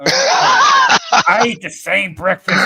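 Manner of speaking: very slow, loud Arnold attempt breaking into laughter; can't hold the Arnold for laughing. Cohost's failed Arnold, laughing through it. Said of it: "I can't fucking do Arnold".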